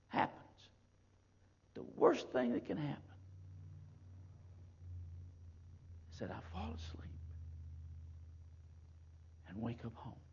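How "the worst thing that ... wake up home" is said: tender hushed preaching; soft repeat. Acted. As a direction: drop to a near hush after the build. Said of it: "repeats softer".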